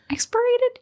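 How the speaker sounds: expirated? asked high and hesitant; unsure rising question. Shown as a demonstration. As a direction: try the word expirated? doubtfully. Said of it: "Yeah, expirated".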